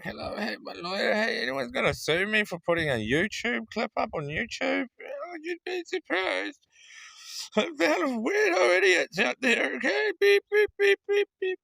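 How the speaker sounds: hammed-up high voice on a call to the lawyer; high silly phone voice. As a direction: squeak through a mock phone call.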